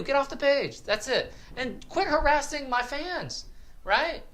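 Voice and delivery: high, heated scolding of the commenters; angry rant. Real, not acted. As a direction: tell the whiners off, fired up. Ranting at whiners on his page.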